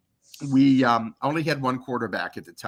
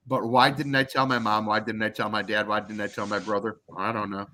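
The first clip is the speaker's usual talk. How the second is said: normal talking voice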